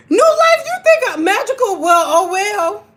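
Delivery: high, loud, breaking squeal of disbelief; excited squeal. Real, not acted. Streamer squealing at chat, high and loud. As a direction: squeal at the chat's guesses. Reacting to viewers' guesses.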